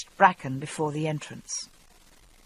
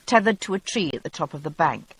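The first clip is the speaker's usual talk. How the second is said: ordinary talk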